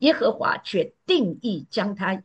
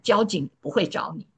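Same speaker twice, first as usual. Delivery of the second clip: usual speaking voice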